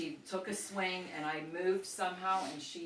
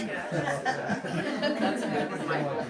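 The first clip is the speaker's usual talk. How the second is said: laughing; 4 semitones lower, 4 dB louder, slower than usual. Real, not acted. Reacting to funny story.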